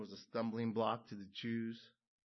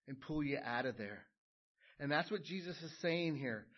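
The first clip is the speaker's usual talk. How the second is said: ordinary delivery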